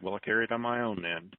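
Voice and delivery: ordinary talk, nothing marked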